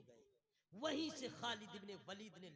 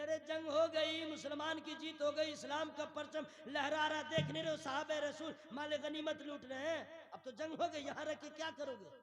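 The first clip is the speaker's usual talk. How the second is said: lightly acted urgent protest, higher pitch; urging argument; 8 semitones higher, 10 dB louder than usual. Companions urging others to leave the hill, arguing. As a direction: press the point in one raised run.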